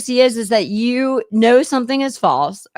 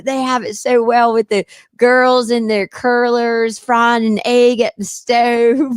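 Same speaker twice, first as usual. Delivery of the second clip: long, slow imitated Southern vowels; Mary's Southern drawl; slower than usual. Friend describing the girls in curlers, Southern drawl. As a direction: imitate a Southern belle, unhurried. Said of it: "She talks like this".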